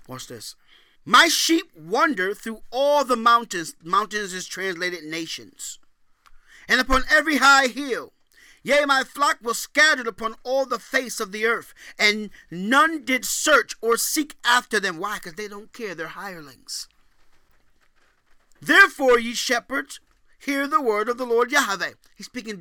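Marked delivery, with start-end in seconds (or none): 1.0-3.7 loud, high preached scripture; scripture reading. Acted. Preacher booming scripture to the congregation. 6.7-15.0 loud, high preached scripture; scripture reading. Acted. Preacher booming scripture to the congregation. 18.6-21.9 loud, high preached scripture; scripture reading. Acted. Preacher booming scripture to the congregation.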